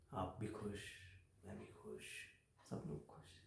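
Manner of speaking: very soft, fading almost to nothing; quietly seal a shady deal; hushed coaxing. Acted. Employee offering boss a mutual benefit, hushed coaxing.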